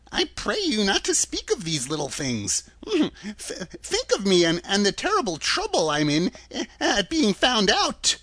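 put-on faltering, high old-man voice; pleading little man. A narrator's reading. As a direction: appeal timidly to the group.

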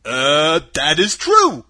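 loud scarecrow voice; husky. A narrator's reading. Scarecrow agreeing with Dorothy, husky. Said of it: "in a rather husky voice".

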